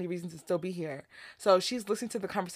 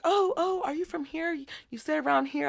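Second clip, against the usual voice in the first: a hint of an eager man, much higher pitch; mocking the man; 8 semitones higher than usual. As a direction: ask it like a keen flirt, pitched up. Man hitting on her, voiced.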